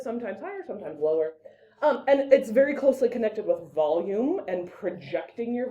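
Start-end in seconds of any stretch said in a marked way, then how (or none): 0.0-0.6 lifted a little on higher; higher pitch. Shown as a demonstration. Teacher demonstrating intonation, lilting the pitch upward. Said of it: "it should sound a little bit". 0.7-1.3 lower pitch. Shown as a demonstration. Teacher demonstrating intonation, dropping her pitch low. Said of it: "it should sound a little bit".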